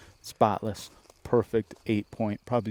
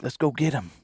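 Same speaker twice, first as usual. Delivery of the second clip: eager brother; 7 semitones higher, 4 dB louder than usual. Retold or imitated. Retells brother's eager urging.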